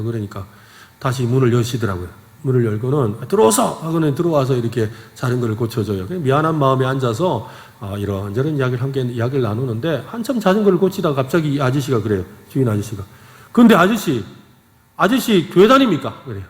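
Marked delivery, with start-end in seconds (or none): 3.2-3.6 high, brisk call to come in; lightly acted gruff welcome; retold brusque invitation. Retold shop owner grudgingly lets him in. 13.5-16.2 raised, louder sudden challenge; spring a blunt question on a customer; imitated shopkeeper's pointed query; retold abrupt question.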